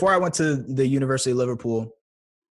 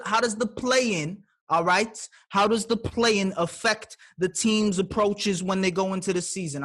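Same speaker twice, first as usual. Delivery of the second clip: fired-up sports commentator; 7 semitones higher than usual. Acted. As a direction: rant like an excitable sports pundit.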